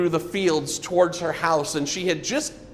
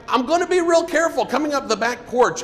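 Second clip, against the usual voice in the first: put-on quick, higher child's call; yelled back; 10 semitones higher, faster than usual. Johnny calling back to the missionary while running, quick yell. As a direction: shout it back fast, a little kid running. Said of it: "he yelled back".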